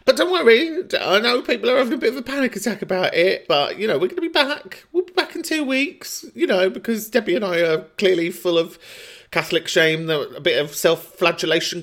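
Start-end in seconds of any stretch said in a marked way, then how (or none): 0.1-8.3 high sing-song reassurance; mock reassuring. Real, not acted. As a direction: soothe them with a mocking lilt.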